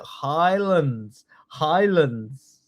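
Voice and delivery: drawn-out Scottish accent; sing-song Scottish model for repeating. Shown as a demonstration. Teacher saying it with everyone, Scottish accent. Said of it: "Give me your best Scottish accent".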